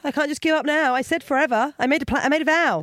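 loud, high-pitched mock protest; mock indignation. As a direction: protest as if your sacred vow is at stake. Mock protest over keeping a vow.

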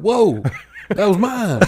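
high laughing yelp of outrage; laughing mock protest. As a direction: squeal it out, half laughing. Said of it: "I said".

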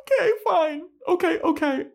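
very high, loud blubbering yes; mock crying Anya. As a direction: sob out the acceptance, overwhelmed. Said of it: "Anya's like".

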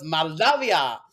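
loud mock Moldavian accent; unrecognized foreign accent. Audience woman naming her homeland, imitated foreign accent. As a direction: announce it in a put-on foreign accent. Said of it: "in this very different accent".

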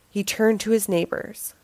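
usual speaking voice

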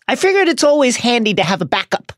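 Ron voice. Acted. Ron voiced in book reading.

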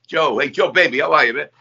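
lightly done Hoboken crooner greeting. Retold or imitated. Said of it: "that [name], North Jersey, Hoboken accent".

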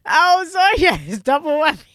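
high, loud mock apology; mock outburst. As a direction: blurt it out high and loud, joking. Mock reaction to the bill.

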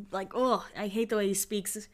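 ordinary talk

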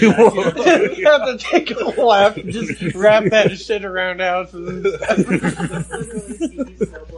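high, loud cartoonish voice. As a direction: play it like a wacky cartoon. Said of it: "I went straight cartoon on that shit".

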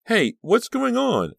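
put-on high, loud, drawn-out child's voice; shrill little brother. A narrator's reading. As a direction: pipe up shrilly like a small boy. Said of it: "a shrill voice demanded".

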